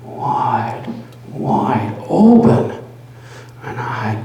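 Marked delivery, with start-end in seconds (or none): 1.3-2.7 higher, louder, slow; horrified burst. Acted. Narrator seeing the vulture eye, horrified. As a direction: let it burst out in horror. Building horror.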